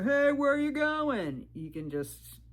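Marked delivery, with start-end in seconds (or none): 0.0-1.5 high, loud, slow imitation of a leering man; hammed-up catcalling hiker; mock catcall. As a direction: call out like a creepy guy on the trail. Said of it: "these guys go".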